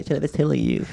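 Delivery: high, put-on girlish squeal of recognition; mock delighted girl. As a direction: imitate a girl tickled by it. Voicing delighted girls in story.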